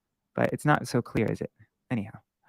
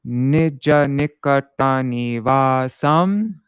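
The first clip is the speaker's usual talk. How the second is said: "Nija nikata nivasam" chanted slowly, louder, syllable by syllable; metered recitation; 6 dB louder, much slower than usual. Shown as a demonstration. Teacher demonstrating the verse meter before the pause, slow and loud. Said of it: "So we go".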